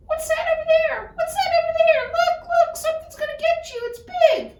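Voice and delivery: a sly little leprechaun crying fire to distract him; high leprechaun pointing, pretending panic with a laugh; tricky leprechaun. Leprechaun tries second trick.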